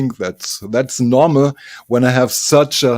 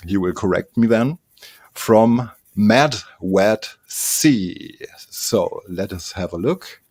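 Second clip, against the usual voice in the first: ordinary talk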